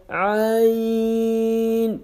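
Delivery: عین drawn from the throat with pressure; throaty long عین; pressed stretched Ain. Shown as a demonstration. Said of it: "pressure کے ساتھ پڑھنا ہے".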